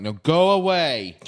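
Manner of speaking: loud, drawn-out dismissal; mock shooing. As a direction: shoo them off, slow and emphatic. Retold crew shoos the Ferengi off.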